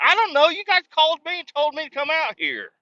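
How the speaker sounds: high, loud mock-indignant; incredulous high-pitched sass; exasperated retort. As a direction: throw it back at them, squeaky with disbelief. Retelling his reply to workers.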